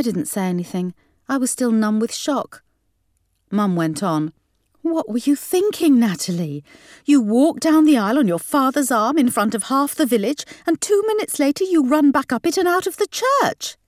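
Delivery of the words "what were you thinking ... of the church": high, exasperated scolding; berating mum. A narrator's reading. Mum berating her runaway bride daughter, high exasperation. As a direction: scold with rising pitch to the end.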